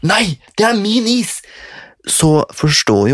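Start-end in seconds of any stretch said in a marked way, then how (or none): 0.0-1.4 high, piercing shriek; retold shriek. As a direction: shriek it like she's being robbed. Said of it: "etter å ha skriket sånn".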